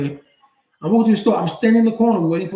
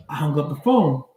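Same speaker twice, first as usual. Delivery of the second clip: usual speaking voice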